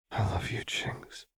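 soft, intimate whisper. A narrator's reading. Lux declaring love to Jinx, whispering. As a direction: whisper it tenderly, fading to breath. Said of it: "Lux whispered".

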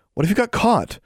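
a friend fretting about getting caught; worried plea. A narrator's reading. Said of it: "Anna was concerned".

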